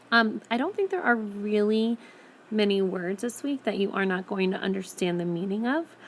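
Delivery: usual speaking voice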